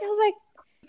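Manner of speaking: ordinary delivery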